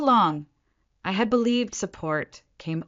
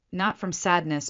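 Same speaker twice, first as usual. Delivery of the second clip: plain reading voice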